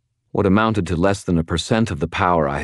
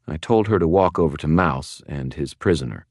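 plain reading voice

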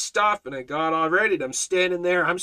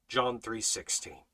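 usual speaking voice

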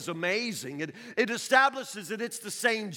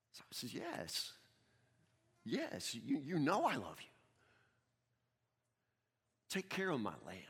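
soft, low, intimate reading of the dialogue; hushed storytelling; 11 semitones lower, 12 dB quieter than usual. Acted. Preacher retelling Jesus questioning Simon, hushed and soft. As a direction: draw them in quietly to the tender scene.

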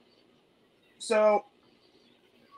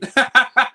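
loud, high burst of laughter; laughing; 9 semitones higher, 10 dB louder than usual. Real, not acted. Cracking up at the co-host's joke, loud laughter.

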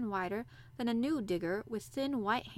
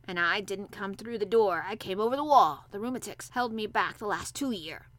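put-on old Yorkshire gardener; 7 dB louder than usual. A narrator's reading.

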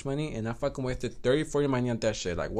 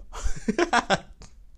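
high laugh; 8 semitones higher than usual. Real, not acted. Laughs after the quote.

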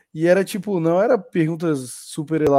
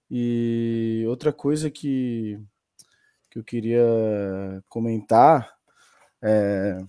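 trailing off; 5 semitones lower, much slower than usual. Real, not acted. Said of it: "me deu um branco aqui".

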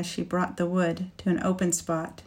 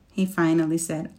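ordinary delivery, nothing marked

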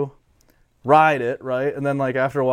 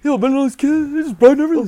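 much higher, louder frantic Sal impression; telling Bennett, Sal shrieks in a high voice; freaking-out Sal; 11 dB louder, faster than usual. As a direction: squeal like panicking Sal. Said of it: "Sal was freaking out".

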